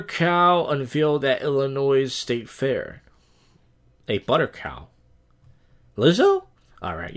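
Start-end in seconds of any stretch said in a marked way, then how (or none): 6.0-6.4 surprised outburst. Real, not acted. Vlogger reading a [name] headline, surprised outburst. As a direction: yelp the name in disbelief.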